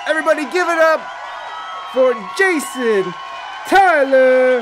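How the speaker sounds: loud, high showman shout; announcer's guest intro. Real, not acted. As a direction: belt out the name, stretching the last word. Hyping a guest's stage entrance.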